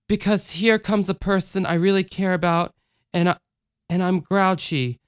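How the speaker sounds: put-on small, worried child confessing; scared, grouchy child. Voices the child.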